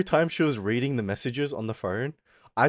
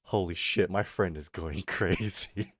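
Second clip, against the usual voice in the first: low, slow retold disbelief, ending in laughter; mock stunned disbelief; 6 semitones lower, 5 dB quieter, slower than usual. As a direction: mutter it low as if stunned, then crack up. Retold stunned reaction to messages.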